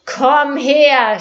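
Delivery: loud furious shout from afar; angry shout. Acted. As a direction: bellow the order in anger. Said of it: "schallte es zornig".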